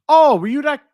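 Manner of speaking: high, loud oh of remembering; sudden recognition. Real, not acted. Streamer suddenly placing a viewer's name, high and loud. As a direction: it clicks, rising high and loud.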